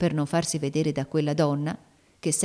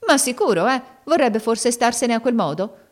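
raised, loud and sharp retort; sharp rebuke; 7 semitones higher, 5 dB louder than usual. A narrator's reading. Maid rebuking the girl's question, loud and sharp. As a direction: snap back at her, loud. Maid scolds timid girl.

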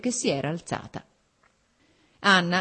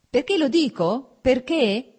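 higher, louder irritated retort; angry husband repeating why; 7 semitones higher, 5 dB louder, slower than usual. A narrator's reading. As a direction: throw the question back at her. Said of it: "continuò sempre irritato lui".

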